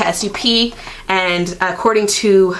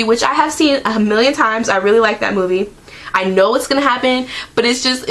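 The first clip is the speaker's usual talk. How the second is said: ordinary talk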